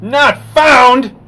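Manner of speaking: loud, high cry of dismay; frustrated outburst. Real, not acted. Streamer yelling at a failed link. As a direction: blurt it out at the screen. Error page after typing long URL.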